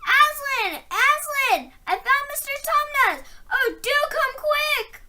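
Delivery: high joyful calling; high, loud and breathless with joy; excited shout. A narrator's reading. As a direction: call out in delight. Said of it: "shouting out".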